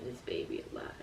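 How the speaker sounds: whispered under the breath. Retold or imitated. Mother recalling her resolve to keep the baby, whispered. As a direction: let the last words fall to a whisper.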